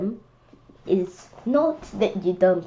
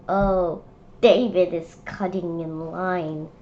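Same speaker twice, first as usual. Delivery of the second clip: ordinary delivery, nothing marked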